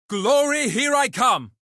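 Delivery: loud, high-pitched heroic cry; rallying battle cry. Acted. Freed fighter rushing into battle, exultant cry. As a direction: call it out as you charge for glory.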